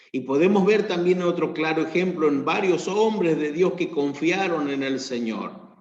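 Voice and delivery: loud, driving sermon delivery; preaching at full force. Acted. Pastor preaching to his church, loud and driving. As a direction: proclaim it to the congregation.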